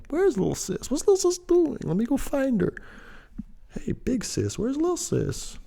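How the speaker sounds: high falsetto, little voice; squeaky acted thought. Acting out the urge to find Little Sis, high falsetto. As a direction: pipe it up in a put-on high voice.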